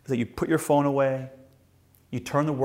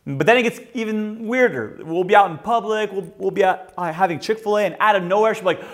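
usual speaking voice

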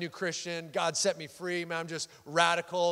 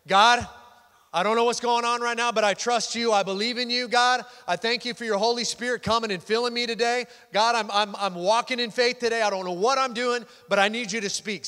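lifted-voice prayer; 5 semitones higher, 5 dB louder than usual. Shown as a demonstration. Pastor showing the church how to pray aloud, raised voice. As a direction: pray out loud, louder and higher. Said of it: "you just gotta lift your voice and say".

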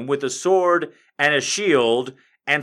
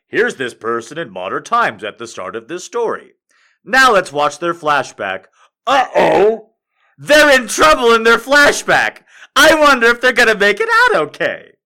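hammed-up teaser voice, rising higher and louder; mock TV narrator; 5 semitones higher, 6 dB louder than usual. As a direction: tease the audience like a cheesy promo. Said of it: "they'd be all like".

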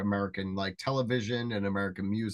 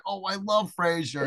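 high-pitched pretend adoration; mock gushing; 7 semitones higher than usual. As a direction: play the sweetly enthusiastic fan. Retelling the expected fan reaction.

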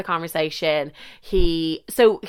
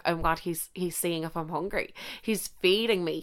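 much softer, breathless awe; hushed swoon; 9 dB quieter than usual. Retold or imitated. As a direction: murmur it like a secret thrill. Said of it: "it's literally like".

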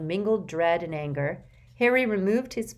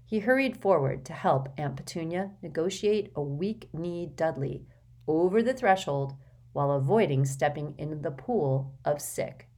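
usual speaking voice

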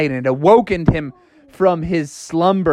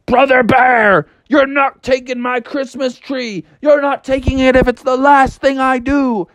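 defiant high father; 8 semitones higher than usual. Acted. Father defies bear.

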